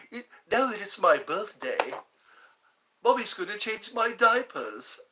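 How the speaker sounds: smug cartoon-baby voice; Stewie voice. Stewie impression continues.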